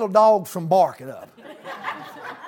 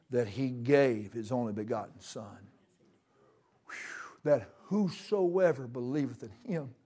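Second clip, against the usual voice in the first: hushed scripture; 8 semitones lower, 10 dB quieter than usual. Real, not acted. Preacher reciting John to the congregation, hushed. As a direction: lower the voice into reverence.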